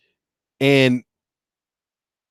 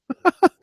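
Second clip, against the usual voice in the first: short laugh. Real, not acted. Laughs at own joke.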